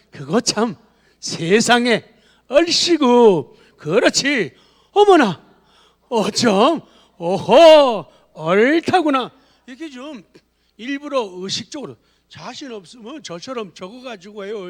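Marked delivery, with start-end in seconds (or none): 0.1-9.3 loud, rising burst of delighted exclamations; exaggerated exclamations. Shown as a demonstration. Preacher demonstrating exclamations to the congregation, loud and delighted. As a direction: pile on the wonder, each one bigger. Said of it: "자, 시작".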